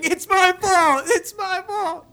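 high, slow hammed-up wail; mock wailing. Grown dad still wailing at his son. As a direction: sob it out like a kid. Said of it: "I'd still be saying".

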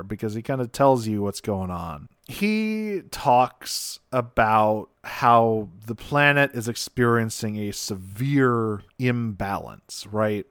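usual speaking voice

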